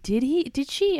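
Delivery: ordinary talk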